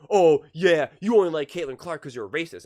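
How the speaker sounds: a loud snap of mock accusation on the opening Oh yeah; mock accusing. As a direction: bark it like a smug accuser, punchy and quick. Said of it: "people are like".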